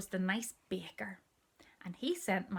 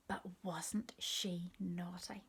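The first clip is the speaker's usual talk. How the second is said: conspiratorial whisper; 7 dB quieter, slower than usual. A narrator's reading.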